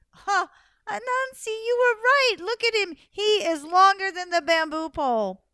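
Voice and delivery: high, loud, gushing astonishment; feigned amazement. Acted. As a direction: exclaim as if utterly amazed. Wife feigns amazement at python.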